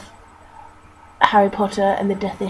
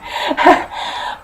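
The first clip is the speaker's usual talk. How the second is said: breathy laugh at herself; embarrassed laugh; 7 semitones higher than usual. Real, not acted. Laughing at her own flubbed reading, embarrassed laugh. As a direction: laugh off the mistake. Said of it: "what is wrong with me".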